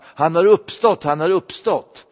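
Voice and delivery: loud, high-pitched herald's call; proclaiming cry. Acted. Preacher shows what preaching the gospel means, calling it out. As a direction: cry out the good news. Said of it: "det är alltså att ropa".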